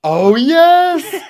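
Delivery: loud, high drawn-out cheer; delighted outburst. Real, not acted. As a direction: cry it out in gleeful recognition. Delighted cry at recalled gag.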